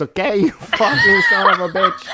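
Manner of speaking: playful hammed Italian wiseguy; mock Italian accent. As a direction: tease his friend's Italian accent. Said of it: "imitate your accent".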